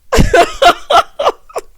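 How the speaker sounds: high, loud real cackle; uncontrolled peals of laughter; cackling. Real, not acted. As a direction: burst out cackling helplessly. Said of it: "that made me cackle".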